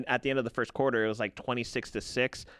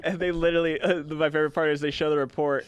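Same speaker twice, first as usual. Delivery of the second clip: ordinary talk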